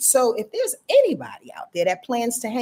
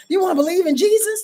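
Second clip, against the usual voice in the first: booming, much higher and louder, like a preacher on high; God voice; 10 semitones higher, 6 dB louder, faster than usual. Acted. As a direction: speak as God proclaiming from above. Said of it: "This is my God voice".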